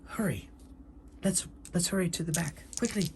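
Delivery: anxious in-character hurrying; urgent hushed hurry. Acted. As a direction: urge them on without raising your voice. Character urges flight from the guard.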